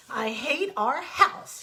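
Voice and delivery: a loud, slow grumpy rabbit. Acted. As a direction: drag out the complaint, sulking. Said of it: "he said grumpily".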